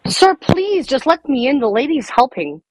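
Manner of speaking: ordinary delivery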